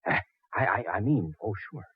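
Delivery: stammering, very soft compliance; hushed agreement. Acted. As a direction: drop to a near whisper, flustered. Lowers voice after being shushed.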